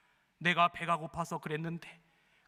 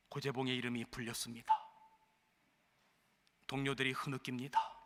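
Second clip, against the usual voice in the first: solemn hush; 5 semitones lower, 5 dB quieter than usual. A narrator's reading. Preacher narrating the execution morning, hushed and grave. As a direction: let the grief settle.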